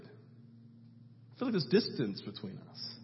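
hushed, near-whispered admission; soft confiding. Retold or imitated. Pastor tells friend gently about the distance, hushed.

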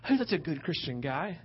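soft, lilting mock admiration; admiring church folk. Churchgoers classifying the man today, softly admiring. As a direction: coo it softly like an impressed onlooker. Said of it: "That's how we would classify him today".

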